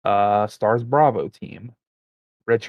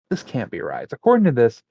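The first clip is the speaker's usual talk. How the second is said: usual speaking voice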